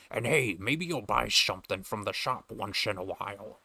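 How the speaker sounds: lightly Scottish sailor; a hint of Connery fisherman; Sean Connery voice. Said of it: "I'm already over voicing this guy".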